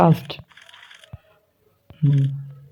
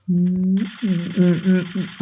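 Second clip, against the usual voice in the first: idle humming; 4 semitones higher, 4 dB quieter, much slower than usual. Real, not acted. Humming while playing.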